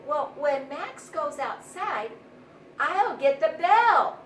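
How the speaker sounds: bold, loud offer, stretched slow; strong mouse. A narrator's reading. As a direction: puff up like a brave mouse. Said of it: "offered a strong mouse".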